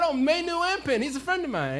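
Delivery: high, loud re-enacted claim; excited insistence. Traveler proving to the vendor he knows him, excited. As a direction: proclaim the friendship at full voice. Insists the man is his friend.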